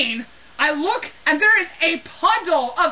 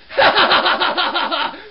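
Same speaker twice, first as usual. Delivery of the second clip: mock gleeful cackle. Imitating the phantom pooing on the floor, mock gleeful cackle. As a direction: cackle like the pleased culprit. Mimics the culprit's gleeful laugh.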